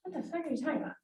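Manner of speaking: muttered disbelief. Retold or imitated. Retold reaction to wife.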